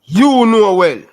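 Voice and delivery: high and loud, hammering YOU; you: ऊँचा, ज़ोर से, ठोक कर; emphatic outburst. Real, not acted. Emphatic insistence to interviewer.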